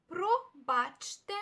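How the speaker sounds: "Пробачте" slowly split into про-бач-те; "Пробачте" at half pace for learners; slow syllable-by-syllable demo. Shown as a demonstration. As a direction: sound out "Пробачте" in separate, drawn-out syllables. Teacher repeats word syllable by syllable.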